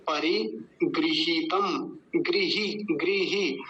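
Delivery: परिगृहीतम syllable by syllable, गृ with an i sound; गृ as gri. Shown as a demonstration. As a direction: say गृही clearly as gri-hee, long ही. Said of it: "ग्रही नहीं बोलेंगे". (गृही में गृ, 'ग्रि' जैसा)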